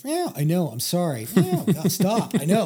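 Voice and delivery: comforting a hurt kitten while jogging home; retold soothing of a kitten. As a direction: soothe a yowling kitten, a bit exasperated. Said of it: "this endless stream of".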